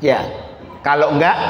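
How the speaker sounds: ordinary talk, nothing marked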